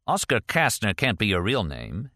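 usual speaking voice

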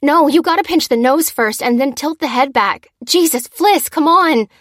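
loud, high exasperated instructions; urgent urging. A narrator's reading. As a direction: urge on a fumbling helper. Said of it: "she urged as the teenager fumbled".

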